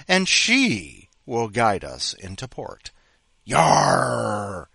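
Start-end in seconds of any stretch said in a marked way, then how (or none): 3.4-4.7 loud pirate growl of yarr. Pretend. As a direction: bellow it like a pirate. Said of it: "Talk Like the Pirate Day".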